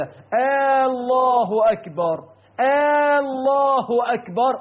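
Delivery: آه الله أكبر on a long, high questioning aah before الله; interrogative hamza mistake. Shown as a demonstration. Preacher imitating worshippers who make takbir a question, stretched aah. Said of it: "فينطقها هكذا".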